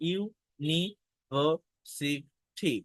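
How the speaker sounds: ইউনিভার্সিটি split into its five syllables, very slow; ইউনিভার্সিটি spoken slowly, each syllable separate. Shown as a demonstration. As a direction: stretch ইউনিভার্সিটি out one syllable at a time. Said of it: "এই শব্দের ভিতর পাঁচটা syllable আছে".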